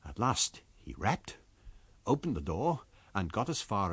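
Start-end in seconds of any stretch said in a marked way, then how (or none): none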